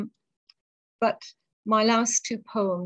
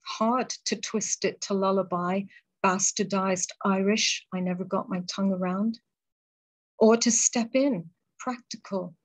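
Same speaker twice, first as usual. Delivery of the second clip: poem recital. Acted. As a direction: hold the lines gently and let the pauses breathe. Reads her deathbed poem.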